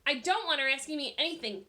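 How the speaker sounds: snapped. A narrator's reading. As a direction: cut her off angrily. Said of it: "Jeff snapped".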